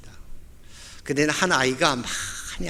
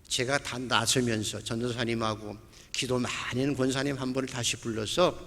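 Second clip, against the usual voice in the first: usual speaking voice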